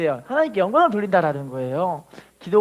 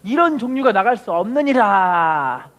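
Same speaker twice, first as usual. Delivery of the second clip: slow, louder recitation to repeat; slow call and response; 4 dB louder, slower than usual. Shown as a demonstration. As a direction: read it slowly for the church to follow. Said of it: "따라합시다".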